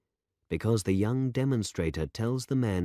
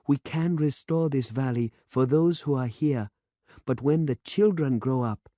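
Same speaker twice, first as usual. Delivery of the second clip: put-on young demonstrator, insistent; 8 semitones higher, 4 dB louder than usual. A narrator's reading. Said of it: "demonstrator insists".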